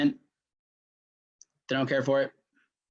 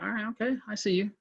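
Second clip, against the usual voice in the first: higher-pitched, lightly acted concession; mock won-over; 7 semitones higher, 4 dB quieter, faster than usual. Skeptical buyer conceding after seeing proof, mock won-over. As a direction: give in as the doubter, pitched up.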